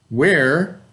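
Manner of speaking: ordinary delivery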